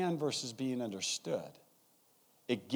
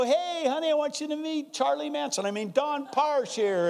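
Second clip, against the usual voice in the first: nervous introduction; 14 semitones higher, 5 dB louder than usual. Retold or imitated. Introducing Don to wife.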